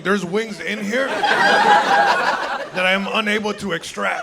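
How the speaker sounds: slow, overly proper phrasing; mock formal. Comedian to college clerk, mock formal and slow. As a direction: spell it out like an official statement.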